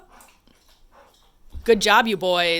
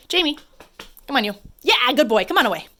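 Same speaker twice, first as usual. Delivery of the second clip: calling dog; 5 semitones higher, 5 dB louder, faster than usual. Real, not acted.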